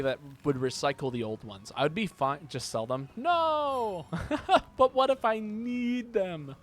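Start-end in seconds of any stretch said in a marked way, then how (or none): 3.2-6.5 high, slow, hammed-up protest; whiny inner voice. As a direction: squeak it out like a panicked hoarder. Argues with himself about selling.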